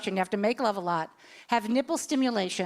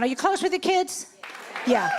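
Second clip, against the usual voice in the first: normal talking voice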